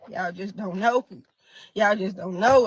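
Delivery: exhausted grief. Real, not acted. Worn out, near tears.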